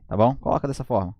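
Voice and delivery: ordinary talk, nothing marked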